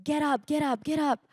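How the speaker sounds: high-pitched, restrained imitation of a screamed command; retold scream. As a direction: imitate a man screaming orders, high but not full volume. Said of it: "he started screaming at us".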